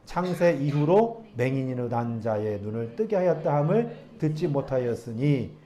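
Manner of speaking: slow, measured Bible reading voice; scripture reading. Acted. Pastor reading the verses to the congregation, measured and formal. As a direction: read the verses out to the church.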